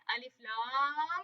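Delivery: अलिफ लाम slowed, with the final म sound of लाम hummed; held ghunna demo. Shown as a demonstration. Teacher showing students which sound should come out. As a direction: draw out अलिफ लाम into a nasal hum. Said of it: "ये वाली sound आनी चाहिए".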